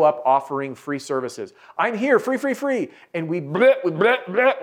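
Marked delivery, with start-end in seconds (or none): 1.8-2.9 high, eager salesman voice; squeaky overeager pitch; mock pushy salesman. Mocking salesman pushing freebies. 3.1-4.6 high retching bleh sounds; mock vomiting. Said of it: "we're vomiting solutions all over".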